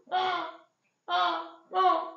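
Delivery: short high shouted cries of outrage; outraged cries. Acted. Laban crying out in outrage at Yaakov. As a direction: bark out a burst of outrage.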